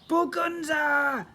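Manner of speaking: high, slow, loud shout. Acted. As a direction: bellow to wake his wife. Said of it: "Mister Crocombe shouted".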